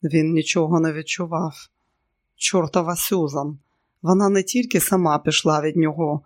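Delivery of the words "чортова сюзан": ordinary delivery, nothing marked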